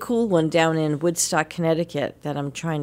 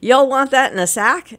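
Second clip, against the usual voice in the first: light mock southern accent, higher and louder; southern clerk imitation; 6 semitones higher, 6 dB louder than usual. Imitating a Maryland grocery clerk asking about bagging, mock Southern accent. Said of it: "they said".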